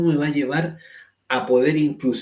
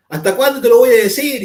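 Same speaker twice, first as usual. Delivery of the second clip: much higher, loud exasperated shout; angry shout; 10 semitones higher, 13 dB louder than usual. Retold or imitated. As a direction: yell it out, fed up. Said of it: "y empieza a levantar más la voz".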